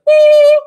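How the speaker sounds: loud, high mock gobble; imitated turkey gobble. Streamer retelling a turkey farm video, mock gobbling. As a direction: gobble loudly like someone riling up turkeys. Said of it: "he goes in there and goes".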